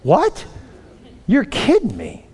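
high-pitched disbelief; incredulous. Retold or imitated. Coach learns the tackler was Jack, incredulous. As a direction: squeal it in mock astonishment.

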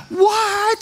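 daughter's dismayed disbelief; shocked child. Retold or imitated. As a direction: squeak it like a stunned kid. Daughter reacts to dad's tease.

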